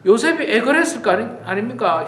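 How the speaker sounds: ordinary talk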